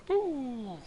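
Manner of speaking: high mock scare; playful boo. Almost hitting a buoy, a high mock boo. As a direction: pop it out like a ghost.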